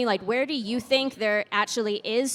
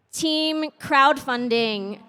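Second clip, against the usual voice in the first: slow, louder call to the room; drawn-out poll call; 4 dB louder, much slower than usual. Real, not acted. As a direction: stretch the team name out for a show of hands. Moderator polls audience by team.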